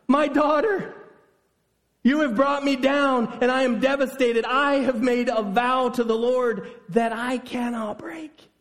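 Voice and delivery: high, pleading lament, slowing at the end; desperate lament. Acted. Father lamenting to his daughter, high desperate plea. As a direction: plead in grief you can't escape. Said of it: "he cries".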